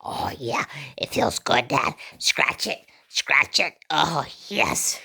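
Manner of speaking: husky half-whispered dog voice; put-on pleasured pet voice; dog's voice. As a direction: voice a dog in scratching bliss. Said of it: "He says".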